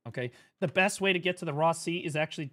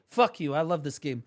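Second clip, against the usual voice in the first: usual speaking voice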